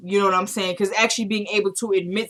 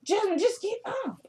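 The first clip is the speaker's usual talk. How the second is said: very high, softer pleading order; high-pitched, real exasperation; exasperated plea; 13 semitones higher, 6 dB quieter than usual. Real, not acted. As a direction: plead in a high voice for them to move. Breaks off to order Jasmine up.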